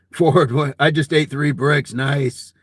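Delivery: ordinary delivery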